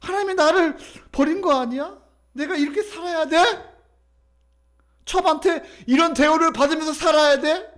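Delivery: high, pleading lament in Hannah's role; anguished self-questioning. Acted. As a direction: cry out in protest at being mistreated. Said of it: "한나도".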